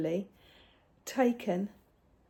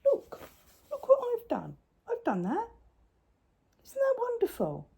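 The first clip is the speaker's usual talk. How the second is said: excited. Real, not acted. Showing off her work gleefully.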